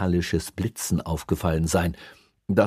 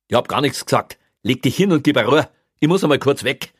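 louder, higher dismissive retort; brusque policeman; 7 semitones higher, 7 dB louder, faster than usual. A narrator's reading. Policeman telling the prisoner to settle down, brusque. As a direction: brush him off loudly and firmly.

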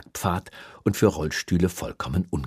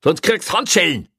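loud, high-pitched warning yell; threatening shout; 12 semitones higher, 7 dB louder than usual. A narrator's reading. Policeman threatening the prisoner with handcuffs, angry shout. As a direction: shout the threat through a door. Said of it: "schrie Kreutner durch die Tür".

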